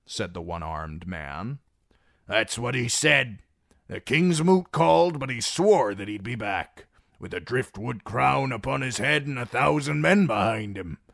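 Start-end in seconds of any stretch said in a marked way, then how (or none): 2.3-11.0 put-on higher, louder old soldier; gruff old graybeard. The one-armed man insisting Victarion will return, loud and high. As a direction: back up the guard with fervour.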